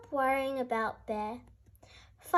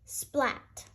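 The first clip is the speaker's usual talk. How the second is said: ordinary delivery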